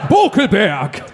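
Dumbledore. Acted. Co-host mimicking the courier as an old wizard, mock wizard voice. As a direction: call the surname like a storybook wizard. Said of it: "Das klingt wie Dumbledore oder so".

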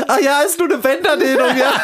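sarcastic laughing retort. Retold or imitated. To his friends who called it a sprain, loud high sarcasm while laughing. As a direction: throw their diagnosis back at them, cracking up. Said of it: "Und ich so zu meinen Leuten".

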